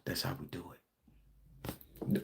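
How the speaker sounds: breathy whispered aside, slow; whispered, slowed aside to viewers. Real, not acted. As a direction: drop to a hush as if sharing a secret. Aside after lesson point.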